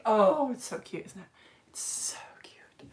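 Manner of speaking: slow, soft whispered coo. Real, not acted. Teacher cooing over a mushroom toy, whispered. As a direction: whisper it, melting at the cuteness.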